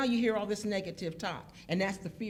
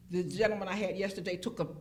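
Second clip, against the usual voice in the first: ordinary delivery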